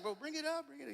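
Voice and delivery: put-on high, urgent hurry-up to a kid; excited urging. Dad telling his son to land the catch, high and excited. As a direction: pitch it high, get him to land it.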